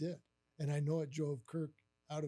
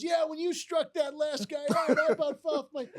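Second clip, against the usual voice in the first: loud, high, stretched-out gushing; excited boss; 11 semitones higher, 8 dB louder than usual. Retold or imitated. Boss congratulating the supposed pitcher, excited. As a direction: imitate a boss raving about the game.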